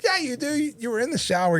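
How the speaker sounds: high, amused disbelief; dad teasing his son who claims ignorance; mock incredulous dad. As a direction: call the kid's bluff with a laugh in it. Dad calling out son's lie.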